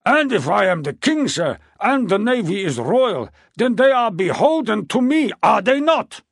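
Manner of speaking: king nearly losing his temper at the court; near losing control. A narrator's reading. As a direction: push the voice up to the edge of a tantrum. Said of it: "The voice began to rise".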